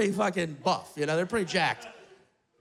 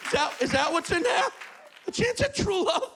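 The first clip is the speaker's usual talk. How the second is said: put-on shouting old man; 6 semitones higher than usual. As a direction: rant at the trees, pitch way up. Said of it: "He's just shouting it".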